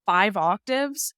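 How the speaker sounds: ordinary talk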